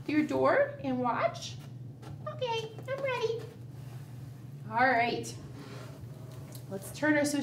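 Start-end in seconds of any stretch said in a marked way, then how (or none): 2.3-3.4 high soft mouse squeak; a tiny mouse whispering ready; squeaky mouse voice. Acted. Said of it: "Miss Mousy".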